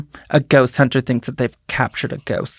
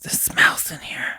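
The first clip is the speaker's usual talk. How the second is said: soft ghostly whisper; 8 dB quieter than usual. Retold or imitated. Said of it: "So you think it says".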